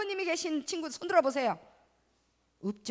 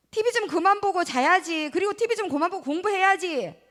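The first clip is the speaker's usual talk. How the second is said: loud, raised scolding mom; nagging parent; 4 semitones higher, 6 dB louder than usual. Acted. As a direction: scold the child like a fed-up parent. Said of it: "이런 말 많이 하시죠?". (큰 소리로 잔소리하는 엄마)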